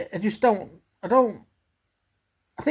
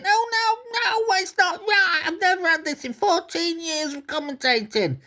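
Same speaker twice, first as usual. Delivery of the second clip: whiny Gary impression; 10 semitones higher than usual. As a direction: mimic him sulking like a hurt child. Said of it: "he's saying".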